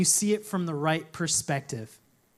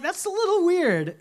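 mock incredulous; 10 semitones higher than usual. Listener's mock incredulous reaction.

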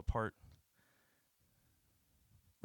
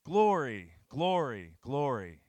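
shouted acclamation, each word falling from high; ringing shout, each word swooping down; loud angelic cry; 5 semitones higher, 14 dB louder, slower than usual. Acted. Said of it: "they're screaming so the walls shake".

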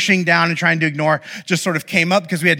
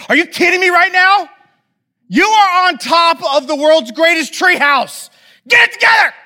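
much higher, loud exasperated yell; furious shout; 13 semitones higher, 7 dB louder than usual. Retold or imitated. Dad losing it at kids on the treehouse, furious shout.

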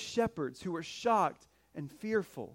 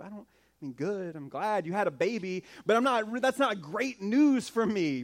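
usual speaking voice

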